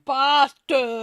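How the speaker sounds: a loud, mocking "Bastard." with dropped r; loud jeering Cockney "Bastard.". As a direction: spit out "Bastard." in a put-on Cockney-Yorkshire voice. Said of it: "It's like".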